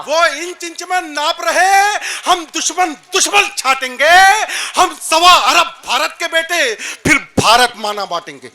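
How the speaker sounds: loud, defiant heroic declamation; forceful poetry recitation. Acted. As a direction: hurl the challenge out to the crowd. Recites verse at full force. (जोशीली ललकार में काव्य पाठ)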